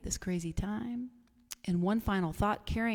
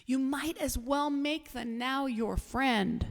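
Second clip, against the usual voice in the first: Eckhart Tolle; 4 semitones higher than usual. Acted. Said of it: "I should say it in his accent".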